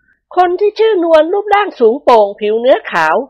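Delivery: high, gentle ladylike voice; high-voiced mistress of the house, pleased; lady of the house. A narrator's reading. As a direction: voice the madam sweetly pleased with her hair. Thada describes maid.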